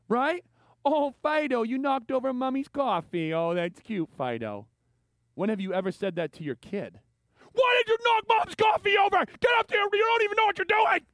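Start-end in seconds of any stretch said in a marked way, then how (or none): 0.8-4.7 high, sugary pet talk fading down; mock-sweet cooing to the dog over spilled coffee. 7.5-11.1 put-on high, loud furious shout at a child; angry parent screaming at the kid who knocked over the coffee; angry parent yell. Yelling at the kid.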